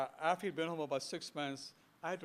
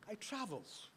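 proud uncle; 6 semitones higher, 7 dB quieter, slower than usual. Retold or imitated. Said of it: "pulled up his full ... height".

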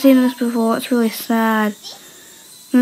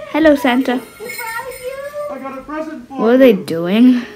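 ordinary delivery

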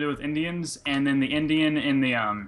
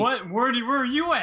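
much higher frantic searching; panicked high call; 11 semitones higher, 4 dB louder than usual. Real, not acted. Gamer searching for his lost friend, high and frantic.

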